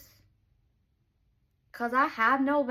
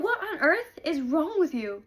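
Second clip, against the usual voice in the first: higher, louder exasperated demand; a hint of an offended woman snapping; mild indignant heroine; 6 semitones higher, 5 dB louder than usual. Heroine demanding of the hero, lightly voiced indignation. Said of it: "She's like".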